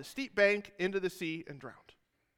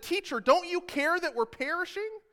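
panicked plea; 10 semitones higher than usual. Acted. Disciples wake Jesus in storm.

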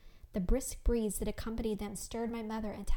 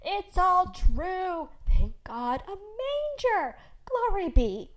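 high, drawn-out joyous cries; exultant shouting, stretched and slow; loud jubilation; 9 semitones higher, 8 dB louder, much slower than usual. A narrator's reading. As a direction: exclaim loudly, overcome with wonder. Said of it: "laughing and speaking so loudly".

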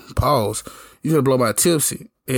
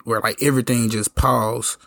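ordinary talk

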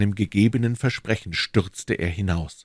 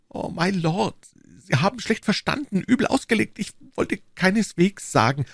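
higher-pitched, hasty protest; flustered queen; 13 semitones higher than usual. Acted. Queen correcting the duke's misunderstanding, flustered. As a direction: take it back in an agitated rise. Queen hastily takes back her hint.

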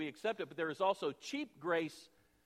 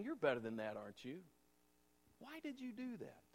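soft reproach; 9 dB quieter than usual. Retold or imitated. World confronts church, softly.

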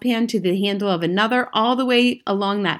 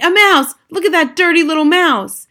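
high, loud cry of alarm; yelling; 7 semitones higher, 7 dB louder than usual. A narrator's reading. Man spots a mouse in the kitchen, yelling. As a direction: yell out at the sight of vermin. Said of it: "I heard a man's voice yelling".

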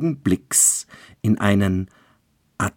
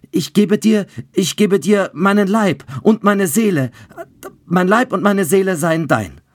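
much higher, loud desperate vow; frantic pledge; 13 semitones higher, 7 dB louder than usual. A narrator's reading. Childeret offers body and soul to the bride, frantic. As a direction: throw everything in, begging.

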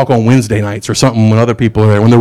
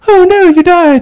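much higher, mock-alarmed girls' voice; alarmed Pevensey girls; 4 dB louder than usual. Pevensey girls to returned Aslan, much higher mock alarm. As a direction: squeal it like shocked girls, hammed up. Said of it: "are like".

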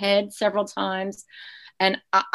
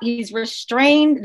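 normal talking voice

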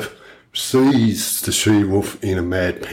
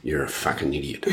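a hint of a stern father, muttered low; imitating the father; 5 semitones lower, 8 dB quieter than usual. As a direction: lower and much softer gruff put-down. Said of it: "his laughs are".

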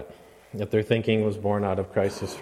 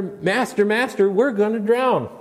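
very high, loud panicked calling; desperate alarm in the storm; frantic cry; 11 semitones higher, 5 dB louder than usual. Retold or imitated. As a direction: disciple screaming at Jesus to wake.

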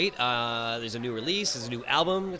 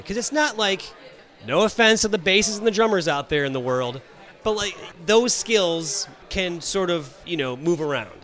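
normal talking voice

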